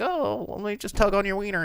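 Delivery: high-voiced woman in a sting; mock come-on. Woman answers the supposed creep, high mock come-on.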